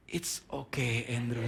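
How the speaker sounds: soft, low mock-soothing reassurance; hushed, overly controlled comforting; restrained calm. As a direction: play a father holding his temper in. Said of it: "saya coba tahan emosi saya".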